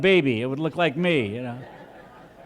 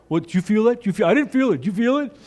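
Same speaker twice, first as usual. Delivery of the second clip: higher, quick-fire bystanders comparing notes; hammed-up casual surprise, pitched up; mock puzzled chatter; 5 semitones higher, 3 dB louder, faster than usual. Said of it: "people go".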